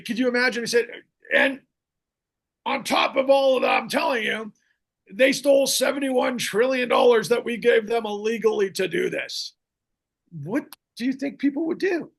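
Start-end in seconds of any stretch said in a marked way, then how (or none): 1.3-12.1 loud, raised-pitch rough impression; Bernie Sanders. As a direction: hammer it out like an irate old orator. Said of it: "But he would say".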